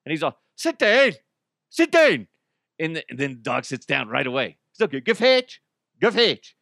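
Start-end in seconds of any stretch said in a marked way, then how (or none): 0.5-2.3 high, loud hammed-up Irish command; heavy Irish accent. Man commanding a dog in a heavy Irish accent. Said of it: "speak in a heavy Irish accent". 5.0-6.5 high, loud mock-Irish command; attempted Irish accent. Man giving his dog commands in a bad Irish accent. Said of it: "if that sounds Irish or not".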